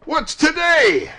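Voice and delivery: high, drawn-out, loud shout; calling down. A narrator's reading. Scrooge to a boy in the street, calling loudly. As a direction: call it out to someone below. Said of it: "cried Scrooge, calling downward to a boy".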